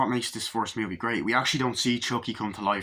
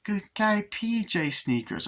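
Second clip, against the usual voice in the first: put-on child voice; 10 semitones higher, slower than usual. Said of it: "He's like".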